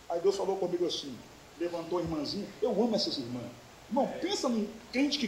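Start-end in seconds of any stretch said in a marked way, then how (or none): none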